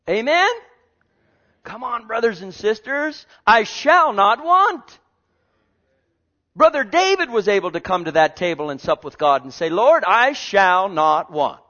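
loud, high, stretched-out proclamation; full-force preaching. Real, not acted. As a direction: rouse the congregation, stretching the scripture.

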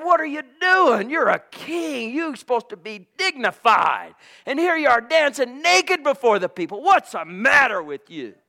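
high, loud mocking scold; mock the dancing king; mocking wife. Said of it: "a wife that mocked him".

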